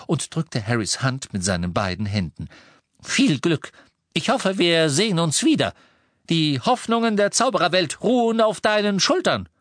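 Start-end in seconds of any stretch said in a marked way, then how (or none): 3.0-9.5 grand farewell. A narrator's reading. Dedalus solemn farewell.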